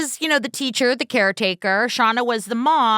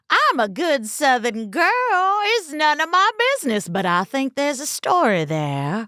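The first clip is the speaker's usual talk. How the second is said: put-on Steel Magnolias Southern accent. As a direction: imitate a proper Southern girl hinting at scandal. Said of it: "Thank you, Steel Magnolias".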